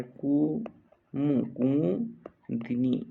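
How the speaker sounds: slow build-up of Kumudini; syllable by syllable. Shown as a demonstration. Teacher dictating to students, Kumudini slowly in pieces. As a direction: drag out each syllable of Kumudini.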